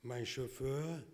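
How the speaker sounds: normal talking voice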